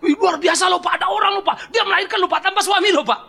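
high, loud breathless gossip voice; hammed-up shocked neighbour spreading news; mock excited gossip. Gossiping neighbour reports the birth, excited and loud.

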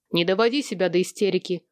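ordinary delivery, nothing marked

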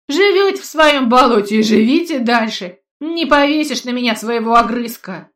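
loud scornful rant; angry. Acted. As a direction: shout him down with contempt. Tall man mocks old man.

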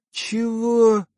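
high, indignant woman's "what?"; huffy, high-pitched disbelief; indignant snort. A narrator's reading. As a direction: throw it out high, as an offended woman. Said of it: "сердито фыркнула она".

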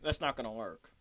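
talking between sips of water; words around a water bottle; while sipping a drink. Real, not acted. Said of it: "Sorry, I'm trying to drink water with the bottle".

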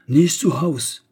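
sharp loud German cry from the maid; loud refusal at the door; servant's shout. A narrator's reading. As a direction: slam it shut with a shout. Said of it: "cria-t-elle".

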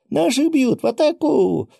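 very high, loud rallying yell; rally the kids to charge, shrieking; battle cry. A narrator's reading. Battle cry in snowball fight. (боевой клич)

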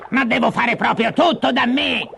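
high-pitched, cross witch complaint; exasperated witch outburst. Acted. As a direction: burst out in high-pitched annoyance. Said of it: "sbottò la strega". (sfogo acuto di strega spazientita)

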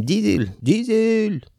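calling a pet by name, rising high. A narrator's reading. Clerk calling his dog Dizel, high and anxious.

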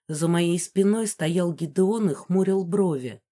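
usual speaking voice

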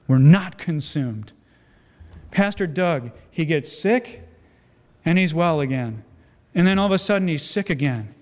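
usual speaking voice

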